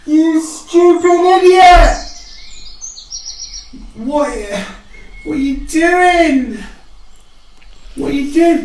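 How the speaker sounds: high squeaky scolding of a dead friend; high scolding grief. Acted. The elf scolds her dead mate in a squeaky high voice. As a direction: scold the body, high and slow.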